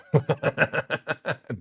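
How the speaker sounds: rapid burst of laughter; long real laugh. Real, not acted. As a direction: crack up at the chat's joke. Amused by a chat joke.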